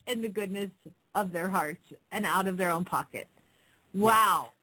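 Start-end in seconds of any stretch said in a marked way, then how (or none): none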